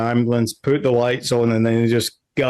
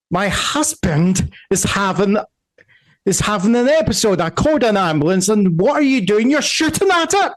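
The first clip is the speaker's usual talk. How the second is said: high, fast Southern scolding; indignant Southern lady, voice climbing; old Southern woman; 9 semitones higher, faster than usual. Acted. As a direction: scold them as an irate Southern matron. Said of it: "that old Southern woman voice".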